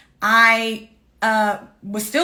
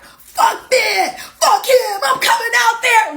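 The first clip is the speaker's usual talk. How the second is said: much higher, half-laughing mock fury; protective family; 12 semitones higher, 5 dB louder, slower than usual. Family hears of the ex's cruelty, mock furious. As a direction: play the outraged relatives ready to ride out.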